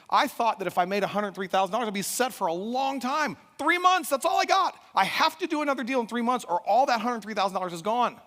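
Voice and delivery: high, loud, escalating outburst; retold tirade at his wife; frustrated rant. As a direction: shout in exasperation, peaking midway.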